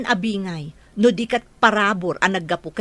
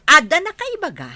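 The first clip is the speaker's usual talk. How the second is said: scolding; 7 semitones higher, 6 dB louder than usual. Acted. Mother scolds son about park.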